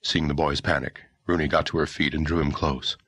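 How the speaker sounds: ordinary delivery, nothing marked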